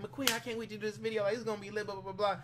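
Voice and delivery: lightly mimicked eager woman; retold good mood. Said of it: "in such a good fucking mood".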